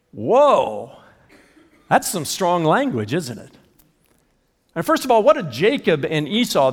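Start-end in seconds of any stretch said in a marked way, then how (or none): 0.1-0.9 loud mock-startled whoa; loud surprised exclamation. Real, not acted. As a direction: react like the verse shocked you. Reacting to harsh verse.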